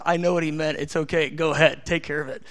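calm, unbothered reassurance; voicing God. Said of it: "God goes".

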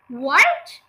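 loud, shocked rising "what"; startled question with a sharp rise; gasped question. A narrator's reading. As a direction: blurt it in shock, sliding up. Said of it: "gasped Mister Twit".

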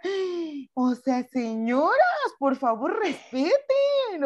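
slow, rising to a very high stretched respeten; exasperated plea. Retold or imitated. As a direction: plead with them in exasperated disbelief. Exasperated at the women. (súplica exasperada, alargando un respeten muy agudo)

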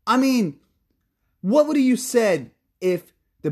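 high-pitched, fired-up complaint; a real heated rant that later needs cooling down. Real, not acted. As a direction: vent at the media, heated and high. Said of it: "All right, I'm gonna cool down".

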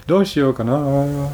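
slow, drawn-out Japanese-style musing; imitating a hesitant Japanese wondering tone; Japanese accent. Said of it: "but usually you're like".

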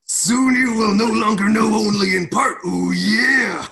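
high, shouted macho wrestler voice; hammed-up promo roar ending in a catchphrase; Randy Savage. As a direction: bellow it like a wrestling star. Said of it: "Randy Savage's voice".

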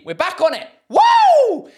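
high, loud "We're back on it" into a squealed "Woo!"; hammed-up party whoop; mock celebration.

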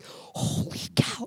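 breathless hushed whisper, drawn out slowly; awed whisper. Retold or imitated. Retelling her amazement at God to the host, in a slow awed whisper. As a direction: whisper it like you can't believe it. Awestruck retelling of God's work.

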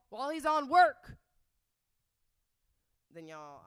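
loud, high, emphatic outrage; indignant rant. Real, not acted. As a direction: build to a shouted last word. Rant against hypocritical former friend.

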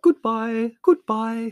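high, loud fluting farewell; fluting Kralevsky. A narrator's reading. Kralevsky bobbing between the family, high fluting. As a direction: sing out goodbye like a flute. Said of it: "fluted Kalevsky".

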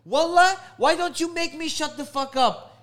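high, loud mocking challenge; mock defiance. The girl mocking his demand, high and loud. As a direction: sneer it back high. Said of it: "She's like".

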